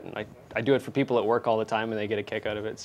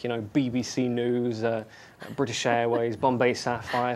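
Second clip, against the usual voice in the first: British accent. Shown as a demonstration. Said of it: "Can you do kind of a little bit of a British accent for us?".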